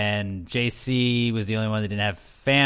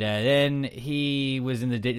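ordinary talk

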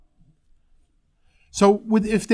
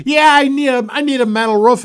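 higher, louder blurted caller demand; imitating a pushy caller; 7 semitones higher, 6 dB louder than usual. Caller phoning the roofing company, blurting out a demand. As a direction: play the caller barging in with what they want. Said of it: "they're gonna start with something like".

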